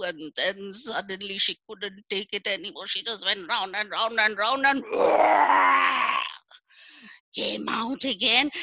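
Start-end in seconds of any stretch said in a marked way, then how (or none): 4.9-6.6 big loud cackle of release; loud gleeful laugh; loud bursting laugh. Acted. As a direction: let out a loud triumphant laugh. Bird bursts out.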